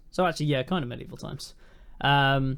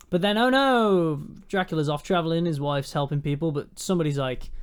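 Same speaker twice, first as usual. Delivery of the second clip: ordinary delivery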